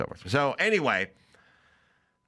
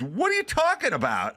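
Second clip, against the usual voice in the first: high-pitched imitated disbelief; incredulous; 11 semitones higher than usual. Friend Mike hears the show was canceled, incredulous. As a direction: blurt it as the baffled friend, pitch up.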